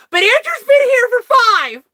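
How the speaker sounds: high, loud announcement; calling out. Retold or imitated. Boss singles out Andrew to the circle, high and loud. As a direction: point out the veteran brightly.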